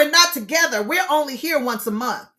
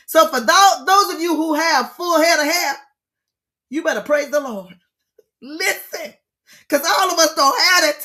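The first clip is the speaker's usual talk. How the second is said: preaching; 4 semitones higher, 5 dB louder than usual. Acted. Preaching to those with hair.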